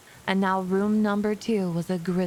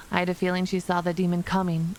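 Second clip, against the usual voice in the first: usual speaking voice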